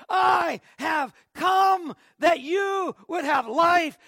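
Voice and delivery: slow, loud, ringing declaration. Acted. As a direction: let the words ring out loud. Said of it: "those words rang out".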